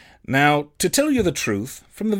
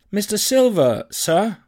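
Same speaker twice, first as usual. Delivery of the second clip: put-on boy's polite query; 6 semitones higher, 4 dB louder, slower than usual. Boy timidly addresses the landlord.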